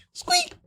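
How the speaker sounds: tiny, very high squeak; short piercing mousy squeak; mouse squeak. Acted. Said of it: "and I'm like".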